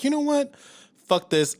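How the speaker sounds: sharp high snap of fed-up anger. Retold or imitated. Hanging up on the Amazon agent, fed up and sharp. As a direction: done with this call. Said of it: "I was so mad".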